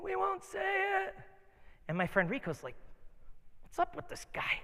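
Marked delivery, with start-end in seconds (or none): 0.0-1.1 demonic voices. Retold or imitated. Possessed guy answering in thirty voices, eerie and high. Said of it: "thirty voices laid over each other". 3.6-4.6 high, breath-only undertone; hushed aside. Retold or imitated. Friend asks about the possessed guy, hushed.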